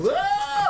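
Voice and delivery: high shrieking goat yell. Acted. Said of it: "And yells".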